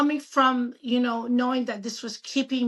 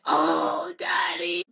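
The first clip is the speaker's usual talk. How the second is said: tearful; 6 semitones lower, much slower than usual. Retold or imitated. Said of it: "And I'd be like".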